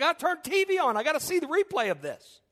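can't wait to see the replay; excited. Retold or imitated. Pastor telling wife he must see the replay, excited. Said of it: "I said".

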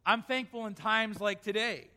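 ordinary delivery

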